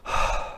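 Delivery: a long breathy sigh demo; heavy sigh. Shown as a demonstration. Teller demonstrates a sigh, heavy breath. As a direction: breathe out heavily. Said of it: "breathe heavily like this".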